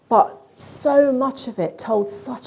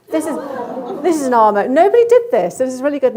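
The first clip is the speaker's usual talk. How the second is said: excited; 4 semitones higher, 6 dB louder than usual. Real, not acted. Laughing at a kitten video.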